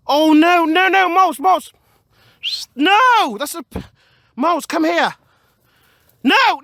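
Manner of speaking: loud, high shouting after the dogs; alarmed calling. Real, not acted. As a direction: call them back in a panic. Calling dogs away from ditch.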